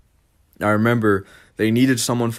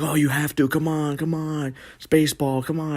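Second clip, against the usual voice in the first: lightly mocking parent voice, pitched up; coaxing parent; 7 semitones higher than usual. Parents pushing their kid to go to baseball, mock coaxing. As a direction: imitate a nagging parent, higher than your own voice.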